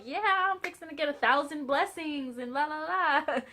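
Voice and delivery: high, loud mock celebration; excited shouting. Wife celebrates promised blessings to husband, excited half-shout. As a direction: whoop it up, re-enacting your own shouting. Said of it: "I start shouting".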